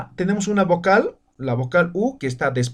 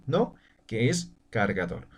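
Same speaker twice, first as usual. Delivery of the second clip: usual speaking voice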